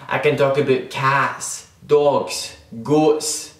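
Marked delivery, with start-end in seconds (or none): none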